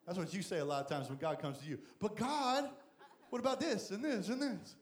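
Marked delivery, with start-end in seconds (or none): 2.0-4.8 higher pleading whine "But God"; mock-whiny excuse to God; whiny complaint. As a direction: whine your excuses up high.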